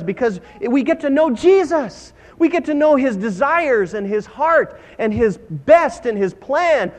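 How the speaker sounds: high, emphatic pulpit exhortation; fervent preaching. Real, not acted. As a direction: preach it with rising zeal. Preaches at full force.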